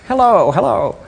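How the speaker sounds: high, squawky parrot copying a phone greeting. Retold or imitated. The pet parrot Jocko mimicking the owner answering the phone, high squawky parrot voice. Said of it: "the parrot would kind of imitate that".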